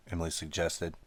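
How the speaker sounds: ordinary delivery, nothing marked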